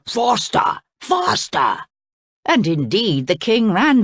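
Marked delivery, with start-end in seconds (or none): 0.0-1.9 high, urgent shouts; shouting. A narrator's reading. Crocodile chasing the running king, shouting. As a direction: shout it high, driving someone on.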